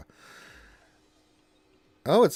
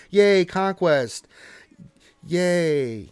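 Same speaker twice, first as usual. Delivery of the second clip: mock cheer; 7 semitones higher, 8 dB louder, much slower than usual. Real, not acted. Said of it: "cheering for game mode".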